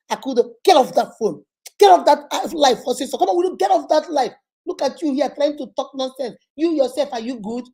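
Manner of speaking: a husband yelling at his wife mid-broadcast; scolding husband. Retold or imitated. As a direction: bark at her to get off the phone. Husband scolding her on live.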